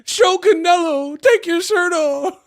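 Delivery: high, slow mock fan voice; squeaky parody of a fan; mocking whiny fan. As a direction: drag it out like a pouting fan. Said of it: "they be like".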